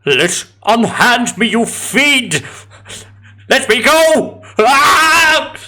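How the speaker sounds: high, panicked screams for help; fluttering cries for help. Acted. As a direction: shriek for help, terrified and rising. Captive woman screaming for help.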